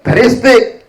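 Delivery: ordinary talk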